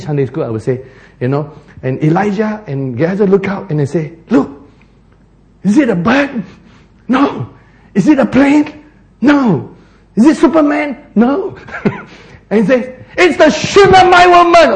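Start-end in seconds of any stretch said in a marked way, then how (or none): 4.3-11.6 high, hammed-up questions and answers; playful lookout. Elijah and Gehazi spotting someone far off, playful mock excitement. Acting out children's Superman-style lookout skit. 13.2-14.8 very high, loud comic reveal; mock-heroic announcement. Lookouts announcing who is coming, mock-heroic high shout. Climax of Superman parody.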